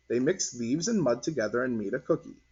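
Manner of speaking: ordinary delivery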